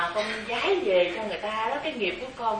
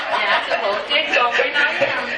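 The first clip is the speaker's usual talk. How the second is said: laughing; 7 dB louder than usual. Real, not acted. Still laughing while answering.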